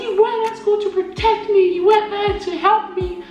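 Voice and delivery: put-on high, childish accusing whine; whiny blaming little sister. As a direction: blame her big sister like a sulking kid. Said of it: "And then I was like".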